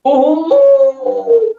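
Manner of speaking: very high, loud squealing "Ooh"; high scream. Acted. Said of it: "screamed the trucks".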